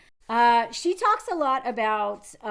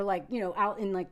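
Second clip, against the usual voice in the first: usual speaking voice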